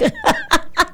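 shrieking burst of laughter; high-pitched cackle of delight; high squealing laugh. Real, not acted. Laugh after mocking read.

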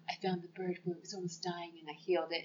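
hushed mock brooding boyfriend; secretive whispered backstory. Vampire boyfriend retold.

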